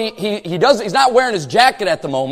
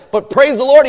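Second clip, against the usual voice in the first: ordinary talk, nothing marked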